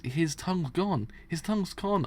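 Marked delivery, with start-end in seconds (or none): 1.3-2.0 pitched higher in alarm; repeating it, higher, to the gang in alarm. Acted. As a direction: say it again, higher and shaken. Horrified gang member spots missing tongue.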